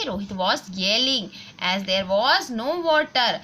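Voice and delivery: put-on slow, careful textbook reading for children; reading aloud slowly. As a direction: read each word clearly for the class. Reads the exercise sentence. (बच्चों के लिए धीरे-धीरे पढ़ना)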